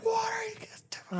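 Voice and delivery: high, whispery old lady imitation; a hammed-up old neighbor asking what's going on; mock old lady. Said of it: "She came out like".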